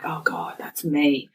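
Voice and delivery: hushed, voiceless aside to oneself; acted whisper of dismay; whispered realisation. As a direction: whisper it as the penny drops. Retold horrified self-realisation.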